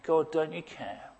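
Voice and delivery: quiet, sinking question; soft despairing plea. Retold or imitated. Disappointed retiree asking God, soft sinking plea. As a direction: let the voice drop away. Retiree's dashed hopes prayer.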